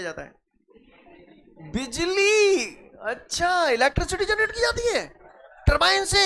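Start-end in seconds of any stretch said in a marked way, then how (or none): 1.7-6.2 high, stretched-out exclamation; high-pitched theatrical enthusiasm; exaggerated excitement. Real, not acted. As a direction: react big to the answer, pitched way up. Reacting with exaggerated interest to answer.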